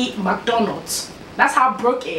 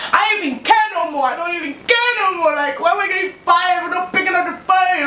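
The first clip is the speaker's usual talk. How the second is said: high-pitched mock whine; retold tearful outburst; 8 semitones higher than usual. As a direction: protest in a hammed-up crying voice. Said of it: "I was crying like a pussy".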